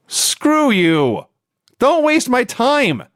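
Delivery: high, drawn-out retold irritation; bad-mood outburst. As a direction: act out being fed up, stretching the words high. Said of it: "I was in a bad mood".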